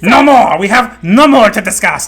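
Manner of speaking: very loud theatrical put-on voice; hammy declamation. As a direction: boom out the pun in mock grandeur. Hammy pun on Namor's name.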